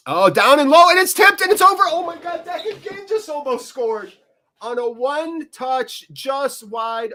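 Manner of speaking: very high and loud, dropping back at the end; fan's shout at a near miss; excited near-goal call. Real, not acted. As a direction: erupt as the shot flies over. Near miss, fan erupts.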